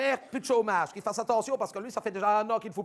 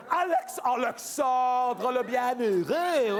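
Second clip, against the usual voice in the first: very slow, drawn-out ironic title; teasing De Croo by nickname; mocking nickname; much slower than usual. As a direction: stretch the nickname like a fairy tale title.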